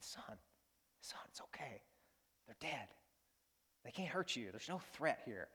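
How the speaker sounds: put-on calm a scared little boy under your breath; father soothing his terrified son, hushed; hushed comfort. Dad comforting his frightened son.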